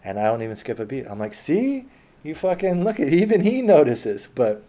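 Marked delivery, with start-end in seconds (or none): 1.3-4.3 high, loud gotcha to a friend; teasing a buddy, pitched way up and loud; gleeful taunt. Retold or imitated. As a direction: crow it like you just won the point. Needling friend loudly in bar.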